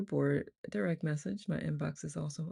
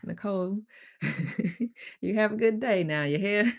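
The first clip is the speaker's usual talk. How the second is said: loud, higher country twang; 5 semitones higher, 6 dB louder than usual. Pretend. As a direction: play up a big country accent. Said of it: "Justin wants me to read in the country voice".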